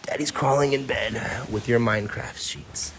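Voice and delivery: ordinary delivery, nothing marked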